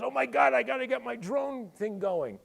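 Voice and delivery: high, loud mock panic; shrill hammed-up "oh my God" panic; panicked copycat. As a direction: squeal like a frantic copycat executive. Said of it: "and everybody goes".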